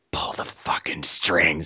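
slow, low, insistent whisper; whispered order. Retold or imitated. She repeats her order to the handcuffed man, slow whisper. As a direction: hiss the order slowly under the breath.

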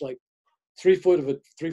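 usual speaking voice

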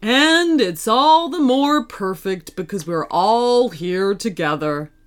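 low warm daddy bat; father bat voice. A narrator's reading. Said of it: "agreed Birdie Batty Bat".

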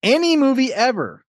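high, teasing echo of ANY MOVIE EVER; high mock echo. Host echoing contestant's soundtrack claim, high mock voice. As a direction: throw the claim back up high.

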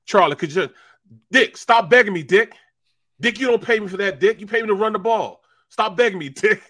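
high, loud, exasperated; mock indignant refusal. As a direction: tell the coach off. Charlie refusing the coach.

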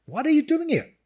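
high-pitched, pressing question; incredulous repeated question. Acted. God's question repeated more insistently.

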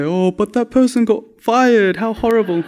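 high, slow mock sympathy; Grace. Friend fretting over the fired person, mocked high lament. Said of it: "trust Grace to say".

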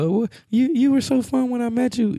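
high-pitched imitation of a girlfriend; complaining girlfriend. Girlfriend complains he was so fun when they met, mock high-pitched whine.

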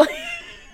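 high-pitched real laugh. Real, not acted. Laughing at own Jesus impression, high giggle.

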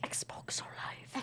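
barely audible whisper; whispered echo. Real, not acted. Player echoing the answer to herself, whispered. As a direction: whisper it back to yourself.

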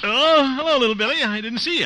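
high, bright fake-cheerful greeting; feigned surprise. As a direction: act startled to see him.

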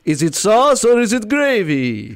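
Long Island vampire. Retold or imitated. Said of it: "It's Long Island vampire".

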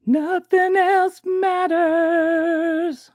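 very high, very slow drawn-out intoning; hammed-up dramatic slogan; dramatic intoned tagline. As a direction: stretch it out like an epic trailer.

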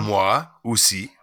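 "Moi aussi" said slowly, mwa-oo-see; French demo. Shown as a demonstration. Guest walks host through the French, slow demo. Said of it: "walk me through it".

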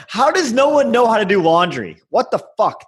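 high-pitched mock exasperation; exasperated rant. New stew ranting about the laundry room, acted exasperation.

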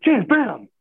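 high, loud panicked call; father yelling at a choking child; alarmed shout. Retold or imitated. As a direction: shout it as you strike. Retelling slapping son's back.

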